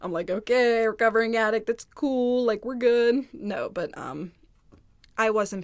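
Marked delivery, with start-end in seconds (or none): 0.3-3.3 loud, mock-cheerful sarcasm; a louder, dry sarcastic okay; sarcastic retort. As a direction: fire back with sarcastic approval.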